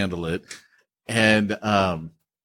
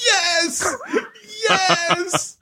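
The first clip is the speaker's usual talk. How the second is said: high, laughing shout of joy; excited cheer. Real, not acted. Host cheering his friend's movie pick, laughing shout. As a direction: cheer like he just won.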